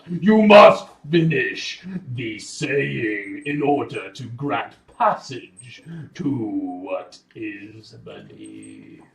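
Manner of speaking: loud, slow proclamation, easing at the end; booming voice. Acted. Game master as magical guardian demanding the password, booming. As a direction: boom it out as a solemn magical command. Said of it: "you hear a booming voice".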